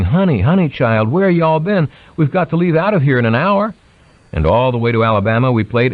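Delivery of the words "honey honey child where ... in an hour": put-on higher, fussing honey-child voice; a light imitation of an aunt, higher and hurried; fussing Southern aunt. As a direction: scold like a flustered auntie in a rush. Aunt voiced hurrying her.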